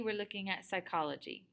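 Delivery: normal talking voice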